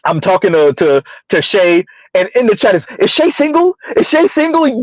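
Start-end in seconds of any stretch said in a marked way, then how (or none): 3.0-4.8 higher-pitched imitation of nosy chatters; mock eager questioner. Host mimics chat members asking about Shay, mock eager. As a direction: pester in a mock high voice.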